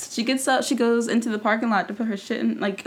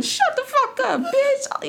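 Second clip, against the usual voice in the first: high mock insult; much slower than usual. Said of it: "She was like".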